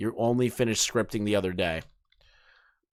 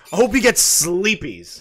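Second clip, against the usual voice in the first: mocking cutesy read; 7 semitones higher, 4 dB louder than usual. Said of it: "Who the fuck talks like this?".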